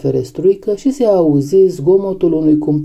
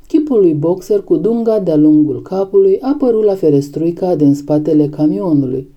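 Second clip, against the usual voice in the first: ordinary delivery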